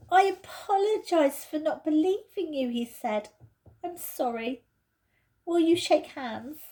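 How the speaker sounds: Peter's voice, apologetic. Acted. Peter apologises to Lucy, sincere. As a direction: make an earnest apology.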